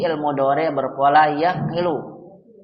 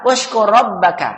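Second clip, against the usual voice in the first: firm drilled order; commanding; 3 semitones higher, 3 dB louder than usual. Shown as a demonstration. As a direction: give it as an instruction. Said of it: "menyuruh atau memerintah".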